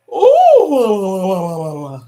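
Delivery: put-on wavering hoot like kids make into a fan; playful warbled "ooh" that falls away; childlike noisemaking. Shown as a demonstration. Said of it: "Remember doing that when you were young".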